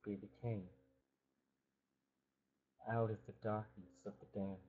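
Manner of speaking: ordinary delivery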